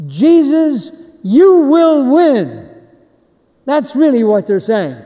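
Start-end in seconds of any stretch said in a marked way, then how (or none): 0.1-2.7 higher and louder, slow, you hit hard; a preacher as ten thousand angels cheering; stadium shout. Shown as a demonstration. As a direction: scream it like a cheering section. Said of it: "angels screaming at the top of their lungs".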